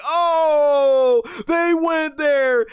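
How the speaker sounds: high, loud scandalised disbelief; mock shock. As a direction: squeal it out like you can't believe it.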